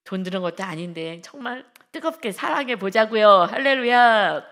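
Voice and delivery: rally the congregation; fervent exhortation. Real, not acted. Sermon climax exhortation. (크게 외치는 할렐루야)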